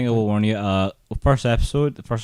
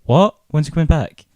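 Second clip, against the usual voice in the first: shocked disbelief; 6 semitones higher, 5 dB louder than usual. Retold or imitated. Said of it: "you're kinda sitting there going".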